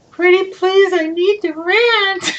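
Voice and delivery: high, slow pleading whine; pretty-please whine, drawn out; mock pleading text. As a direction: beg like a kid asking for a treat. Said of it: "Dave sent me a text".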